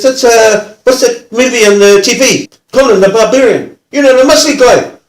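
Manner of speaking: high, loud, urgent explaining; agitated, insistent impersonation, pitched right up; worked-up offender. Retold or imitated. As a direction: imitate a rough bloke groping for the film title. Imitating offender eager about the film.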